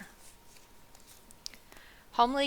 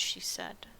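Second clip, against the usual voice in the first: ordinary delivery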